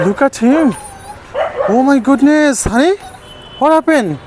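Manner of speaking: high-pitched, shocked dismay; alarmed, voice shooting up in pitch; real alarm. Real, not acted. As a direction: cry out on finding your dog in trouble. Finds the dog fallen and stuck.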